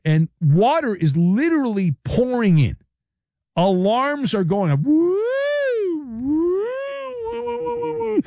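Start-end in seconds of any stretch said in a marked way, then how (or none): 4.8-8.2 high, wailing mock siren whoops; imitated alarm sirens. Host mimicking alarms on the sinking ship, wailing siren whoops. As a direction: whoop like a weird alarm, rising then rapid. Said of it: "That's weird sounding alarms".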